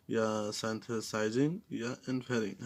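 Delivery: usual speaking voice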